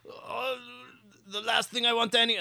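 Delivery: high, half-hearted Scottish police chief; starts a Scottish accent, then gives up; failed Scottish accent. Retold or imitated. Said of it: "I can't do a Scotch accent".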